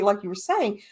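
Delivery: usual speaking voice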